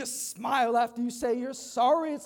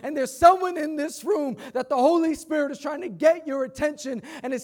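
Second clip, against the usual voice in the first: louder, building preacher cadence; full-force preaching; 5 semitones higher, 10 dB louder than usual. Acted. Pastor assures the congregation of forgiveness, full-force preaching.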